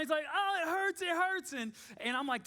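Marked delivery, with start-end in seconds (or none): 0.3-1.5 high-pitched mock wail; whimpering little-boy impression, pitched way up; imitated crying. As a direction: act out a hurt kid crying. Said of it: "he's just crying".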